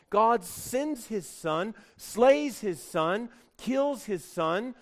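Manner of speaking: each phrase slow and high, pushing harder; slow preaching build, every phrase weighted; slow emphatic build. Real, not acted. Solemn build on sacrifice.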